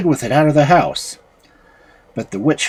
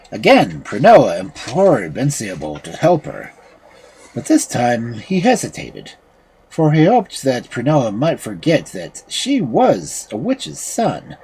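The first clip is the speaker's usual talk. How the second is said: plain reading voice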